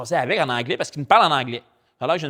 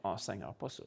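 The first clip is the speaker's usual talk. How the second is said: low and much softer, a quiet sigh of dread; reluctant inner groan; 8 semitones lower, 18 dB quieter than usual. Retold or imitated. Pastor dreading God's call to pray, soft low groan. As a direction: mutter it to yourself, hoping not.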